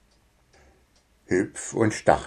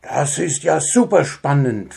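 put-on higher, eager young voice; delighted; 6 semitones higher than usual. Grabi shows father the paper, delighted. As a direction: gush with excitement. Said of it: "freute sich Grabi".

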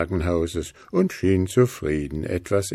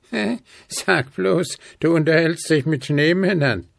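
much higher ghost voice, amused; grinning ghost Spooky; 9 semitones higher than usual. A narrator's reading. Spooky teases Willi about snowmen, grinning. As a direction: tease with a grin. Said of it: "fragte Spooky und grinste plötzlich".

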